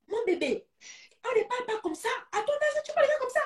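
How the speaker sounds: scolding parent. Acted. Scolding a child.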